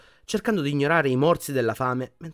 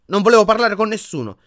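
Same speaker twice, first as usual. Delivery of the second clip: much higher and louder, hurt and defensive; stung; 10 semitones higher, 10 dB louder than usual. A narrator's reading. Harry stung by Ginny's reproach, defensive. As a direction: lash out because it hurt. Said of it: "punto sul vivo".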